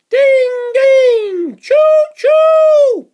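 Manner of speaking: high loud ding ding then whistle; high loud train noises for the kids; train bell and whistle. Acted. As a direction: ring and toot like a toy train.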